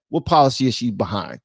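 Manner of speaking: usual speaking voice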